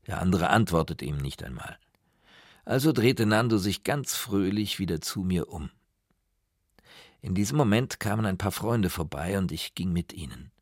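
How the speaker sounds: usual speaking voice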